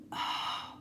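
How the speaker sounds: soft acted sigh of relief; relieved sigh. Reader showing how Pisces will feel, relieved sigh. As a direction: let out a long breath as if relieved.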